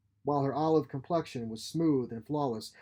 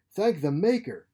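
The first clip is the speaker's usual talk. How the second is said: loud, pitched-up fake relief; desperate cry; 6 semitones higher, 8 dB louder than usual. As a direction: cry out as if saved. Geary feigns desperate relief.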